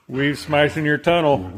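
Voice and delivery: ordinary talk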